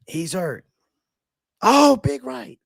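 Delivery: rising to a high yelp on "big right"; hammed-up commentator excitement; excited fight call. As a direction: call the punch as it lands. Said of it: "Do Rogan and say he's hurt".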